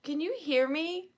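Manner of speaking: usual speaking voice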